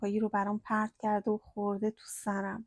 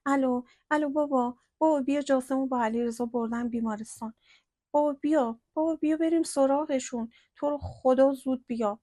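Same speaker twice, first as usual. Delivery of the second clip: trembling voice; 3 semitones higher, 6 dB louder than usual. A narrator's reading. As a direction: beg father on the phone to come. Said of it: "با صدای لرزونش گفت".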